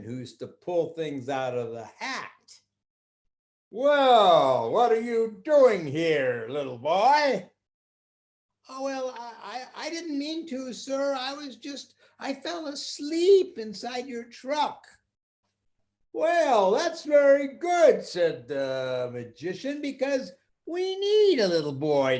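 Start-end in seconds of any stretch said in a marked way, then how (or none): 3.7-7.5 louder, slow, booming man; gruff magician. Acted. Magician finding the stowaway boy, loud booming voice. 8.7-14.9 put-on high, hesitant child's voice; timid little boy. Boy apologizing to the magician, high timid stammer. 16.1-18.0 louder, higher, slow sly man; sly magician. Acted. Magician welcoming the boy, loud sly voice.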